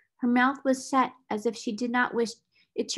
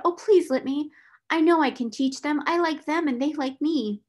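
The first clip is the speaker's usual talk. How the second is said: put-on high, louder, pleading girl; eager exclamation; 6 semitones higher, 5 dB louder than usual. A narrator's reading. Orphaned girl begging to teach the younger children, eagerly. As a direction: plead brightly, pitched well up. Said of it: "exclaimed Sarah".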